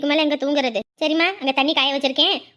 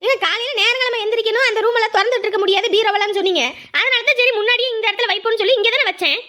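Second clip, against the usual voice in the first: high, insistent arguing; indignant defence; 6 semitones higher than usual. Acted. Wife defending where she put things, indignant. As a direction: argue your case, pitch raised throughout.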